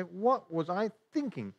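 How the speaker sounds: acted disbelief at your own folly; self-reproach. Preacher playing a regretful sinner, mock exasperation. Acts out regret after sinning.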